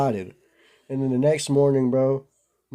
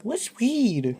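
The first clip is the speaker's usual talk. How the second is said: put-on high, naive little-kid "What's weed?" pitched way up; innocent kid question; 11 semitones higher than usual. Small boy asking older friends about weed, sweetly naive. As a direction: play the clueless seven-year-old asking about "weed".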